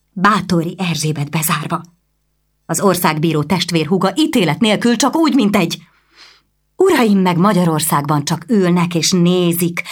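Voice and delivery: a noblewoman's outrage building; agitated noblewoman. Acted.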